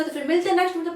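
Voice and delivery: ordinary talk, nothing marked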